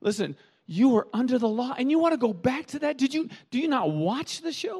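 high, incredulous scolding; exasperated Paul. Retold or imitated. Paul scolds the churches, high and incredulous. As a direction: scold them in disbelief. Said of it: "Paul is kinda saying".

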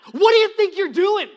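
loud, high furious yell; reenacted protective yell; screaming back. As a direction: scream him away from your child. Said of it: "I screamed back".

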